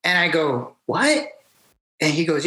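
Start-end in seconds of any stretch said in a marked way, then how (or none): 0.9-1.3 high, rising mock-baffled question; short incredulous query, retold; surprised what. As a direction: act out disbelief, pitch shooting up. Acts out own surprised reply.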